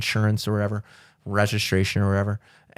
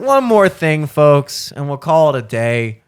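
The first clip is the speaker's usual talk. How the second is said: announcer voice; 3 semitones higher, 6 dB louder, slower than usual. Pretend. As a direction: announce it like a TV host. Host-style announcement.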